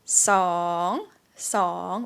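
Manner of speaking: song dips low and rises; Thai rising tone. Shown as a demonstration. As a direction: scoop song down then up. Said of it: "rising tone".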